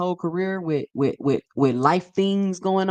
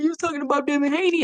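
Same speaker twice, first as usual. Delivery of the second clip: mock accusing quote; 8 semitones higher, faster than usual. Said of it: "y'all not about to gaslight me and be like".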